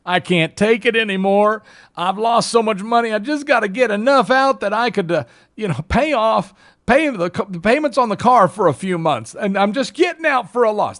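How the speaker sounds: mock crying over lost money; whiny panicked seller. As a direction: whine it like a loser begging to get out. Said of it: "they're crying in their milk".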